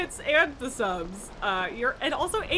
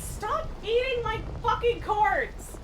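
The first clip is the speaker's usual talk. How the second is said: higher, slow, stressing "fucking"; exasperated scold at cat; 8 semitones higher, slower than usual. Real, not acted. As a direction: drag it out, fed up with the cat. Yelling at cat chewing cables.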